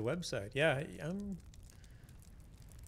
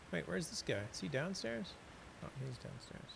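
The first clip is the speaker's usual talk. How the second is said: normal talking voice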